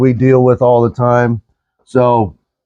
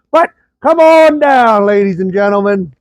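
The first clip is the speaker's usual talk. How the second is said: showman announcer; 7 semitones higher than usual. Pretend. Game-show style invitation.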